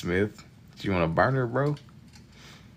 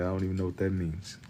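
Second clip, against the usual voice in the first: under breath; 9 dB quieter than usual. Shown as a demonstration.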